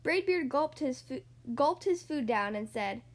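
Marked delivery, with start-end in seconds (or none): none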